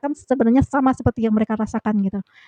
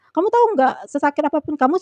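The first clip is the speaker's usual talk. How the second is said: raised-pitch retold lecture, easing slightly; imitated scolding; 5 semitones higher, 4 dB louder than usual. Leader rebukes worker who says she was sick, imitated scolding. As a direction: act out a boss brushing off an excuse. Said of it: "aku diomel-omelin".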